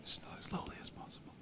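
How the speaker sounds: hushed whisper, barely voiced. Real, not acted. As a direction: sneak past in a whisper. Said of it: "We better be quiet here".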